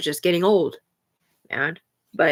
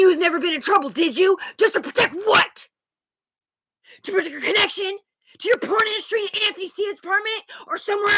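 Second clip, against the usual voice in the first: high-pitched, indignant imitation of a grandmother; outraged grandmother; 10 semitones higher, 5 dB louder than usual. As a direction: confront them, voice pitched way up. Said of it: "she's like".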